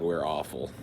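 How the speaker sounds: ordinary talk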